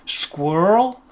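drawn-out American one-syllable squirrel; slow American vowel, all one syllable. Retold or imitated. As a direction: imitate an American saying squirrel as squrrl. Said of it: "whenever I hear Americans speaking".

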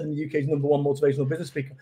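fast, laughing self-proclamation; quick boast. Retold or imitated. [name] recalling what his website claimed, fast and amused. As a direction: zip through it with a chuckle. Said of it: "I just made a website and said".

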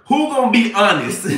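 usual speaking voice

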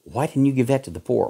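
ordinary talk, nothing marked

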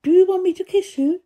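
Mary's voice. A narrator's reading. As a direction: ask it high and stiffly. Stiff Mary asks.

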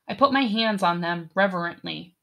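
ordinary delivery, nothing marked

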